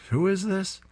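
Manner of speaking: put-on high, puzzled old mother voice; confused mother. Mother on the phone, confused by a stranger, high puzzled voice. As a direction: sound baffled and motherly.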